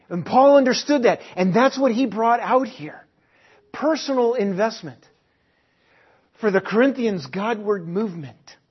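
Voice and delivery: full-force preaching. Acted. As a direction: preach it hard to the room.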